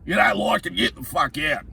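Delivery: lampoon the group's bully with a squeaky sneer; hammed-up jeering workmate voice; mocking crew bloke. Mocks crew bloke laying down law.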